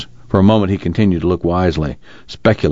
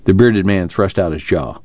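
ordinary delivery

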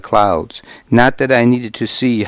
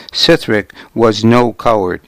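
usual speaking voice

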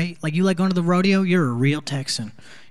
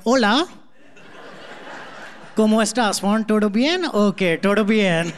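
put-on Spanish greeting in an Indian accent, pitched up a little; 3 semitones higher than usual. Comic imitating himself as a new kid at school, Indian-accented Spanish. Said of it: "speaking Spanish in an Indian accent".

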